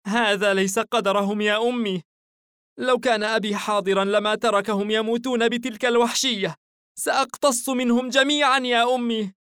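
put-on high, loud child's protest; angry, indignant boy. A narrator's reading. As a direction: vow revenge like a furious little son. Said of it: "أردف الفتى بغضب واستياء".

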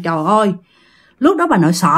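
ordinary delivery, nothing marked

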